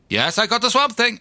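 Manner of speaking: put-on high, botched South African accent; bad South African accent. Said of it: "I can't do accents".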